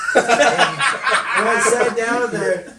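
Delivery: telling it while cracking up; laughing. Real, not acted.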